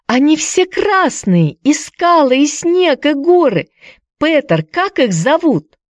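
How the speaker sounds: put-on high, thrilled girl's voice; excited. A narrator's reading. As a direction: gush with wonder. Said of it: "взволнованно кричала Хайди". (восторженно, детским голосом)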